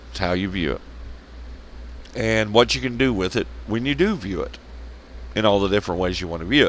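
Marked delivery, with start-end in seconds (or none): 0.1-0.8 softer, dropped low, how still stressed; quiet repeat. Shown as a demonstration. Repeating the point quietly to viewers. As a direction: murmur it back to yourself.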